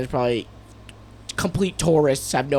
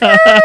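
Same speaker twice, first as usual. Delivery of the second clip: very high, loud shriek; imitated screech; 11 dB louder than usual. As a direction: let out a piercing mock scream. Acted scream as cars brake.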